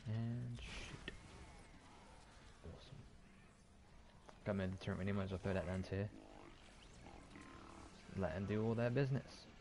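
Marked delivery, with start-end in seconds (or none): none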